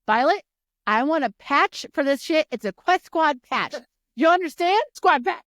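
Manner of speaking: loud, high, insistent demand; hammed-up indignant ultimatum; mock demanding. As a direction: demand it loudly, punching each phrase. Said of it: "He goes".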